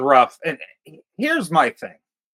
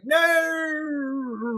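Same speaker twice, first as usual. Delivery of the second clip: long high "no", fading away; mock falling wail; 10 semitones higher, 6 dB quieter than usual. Acting out her falling down the hole, mock fading wail. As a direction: draw it out like someone dropping down a hole. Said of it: "And falls down the hole".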